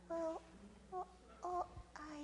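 high, quiet dithering; hesitant Sister Possum. Acted. Possum deciding about the snake, soft high hesitation. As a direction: waver softly in a high voice.

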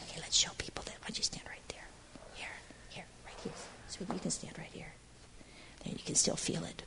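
whispering. Real, not acted. Showing the page to a small child, soft whisper. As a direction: whisper so only the little one hears.

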